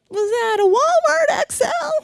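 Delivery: high, shouted alarmed question; mock outburst. Imagined loud outburst over the label.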